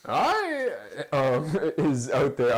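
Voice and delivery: high, slow British villain voice with laughter breaking through; high British Snoke voice. Retold or imitated. Cohost doing Snoke for a laugh, high British voice. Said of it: "weird high British voice that I just tried to do".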